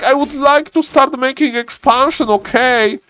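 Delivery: high-pitched imitation of a frustrated player; mock enraged. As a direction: protest at a high, strained pitch. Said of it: "Mister Death Note is enraged".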